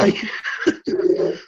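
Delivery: whispered high urgent call. Retold or imitated. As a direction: hiss his name urgently. Urgent hushed call to brother.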